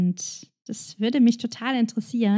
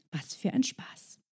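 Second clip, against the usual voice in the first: whisper; 11 dB quieter than usual. Acted. Sarcastic aside in poem.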